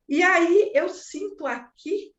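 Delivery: normal talking voice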